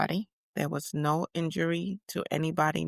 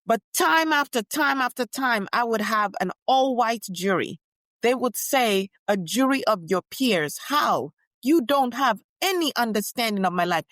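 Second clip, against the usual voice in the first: raised, rousing public address; stirring rally speech, higher and louder; impassioned speech; 9 semitones higher, 6 dB louder than usual. Acted. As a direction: speak out to the crowd with conviction.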